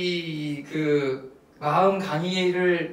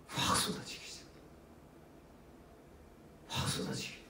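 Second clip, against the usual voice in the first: whispered, moved; 16 dB quieter than usual. Real, not acted. Tears pouring, emotional retelling.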